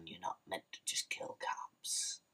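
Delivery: whispered mock mob boss; Carmine Falcone whisper. Vlogger quoting Falcone's rule, whispered. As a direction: hush it like a quiet threat. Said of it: "in the words of Carmine Falcone".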